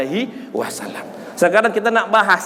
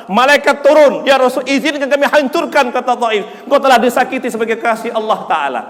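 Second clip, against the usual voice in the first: dramatic, raised storytelling from the pulpit; impassioned preaching; 5 semitones higher than usual. Real, not acted. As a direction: cry out the sacred story with force. (bercerita dalam khotbah dengan lantang)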